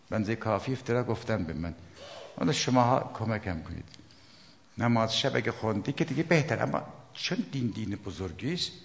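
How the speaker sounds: usual speaking voice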